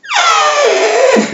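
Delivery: very high-pitched mock shriek; shrill retold panic wail; imitated freak-out scream. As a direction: squeal like someone freaking out after snorting cleanser. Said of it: "she starts freaking out".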